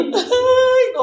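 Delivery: high, loud sobbing wail; mock wail. Girl touched by the people, wailing loud and high. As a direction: cry out as if bursting into tears again. Retold crying when touched.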